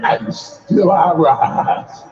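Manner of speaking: slow, deliberate poetic line; Maya Angelou delivery. Shown as a demonstration. Preacher modeling the line for the congregation, slow. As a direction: demonstrate the line slowly for them to repeat. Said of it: "Somebody practice it and say".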